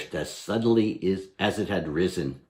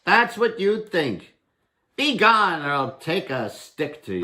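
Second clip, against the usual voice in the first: higher, louder bold warning; defiant Herschel; 10 semitones higher, 4 dB louder than usual. Acted. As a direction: scare him off with a stick threat. Herschel defies goblin.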